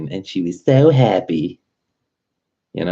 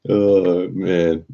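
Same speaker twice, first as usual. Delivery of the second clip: ordinary talk, nothing marked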